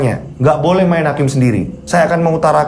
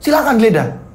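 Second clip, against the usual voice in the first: offended challenge; 8 semitones higher than usual. Acted. Bambang offended by the search.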